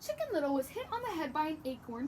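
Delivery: ordinary talk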